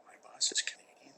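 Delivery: whispered gasp of disbelief; a hushed horrified realization; shocked whisper. Pretend. Acted shocked discovery.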